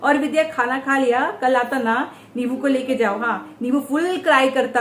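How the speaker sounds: normal talking voice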